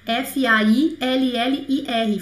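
slow "F A I L L I R", letter by letter; spelled out. Shown as a demonstration. Teacher spelling faillir letter by letter. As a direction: spell it out carefully. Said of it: "spells the word out".

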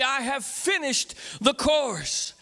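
slow, drawn out for all to join; leading the crowd, slowly together; slow call-and-response. Shown as a demonstration. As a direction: stretch each word so the crowd follows. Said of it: "Say it with me".

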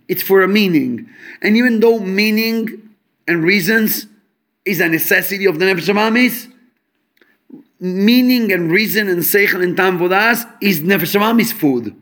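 ordinary talk, nothing marked

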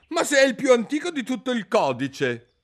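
king defending his invented law; indignant king. A narrator's reading. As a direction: bluster back. King protests.

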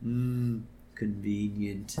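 slow, soft, drooling murmur; drooling over food. Retold or imitated. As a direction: savor the word like he's craving it. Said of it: "he drools over this too".

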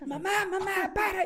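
put-on high-pitched kid calling Mom; retold child's alarm. Child on the truck roof calling to mother, high alarmed imitation.

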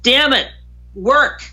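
a mild mock outburst at a broken camera; retold frustration. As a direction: snap at the camera in mild anger. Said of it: "I got angry".

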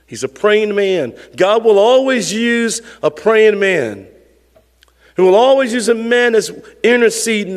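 rising preacher's cadence, leaning on always; preaching. Acted. Pastor urging the congregation to pray, preaching fervently. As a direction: build the point like a pulpit refrain.

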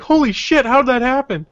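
high, loud astonishment; shocked. Retold or imitated. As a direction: blurt it out amazed. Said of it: "And I'm like".

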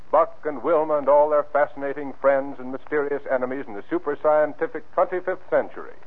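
ordinary delivery

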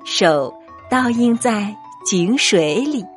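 plain reading voice

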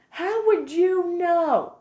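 slow sassy retort; sassy challenge. Real, not acted. Defending against attitude accusation.

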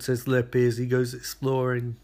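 ordinary talk, nothing marked

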